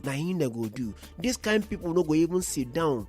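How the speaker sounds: usual speaking voice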